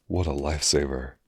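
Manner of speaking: ordinary delivery, nothing marked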